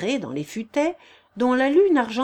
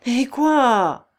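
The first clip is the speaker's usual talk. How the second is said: a sharp, louder cry of dismay; exclaimed; 4 semitones higher, 7 dB louder than usual. A narrator's reading. The wolf-leader cries out to Agnelette in dismay. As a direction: blurt it out, stung. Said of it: "s'écria le meneur de loups".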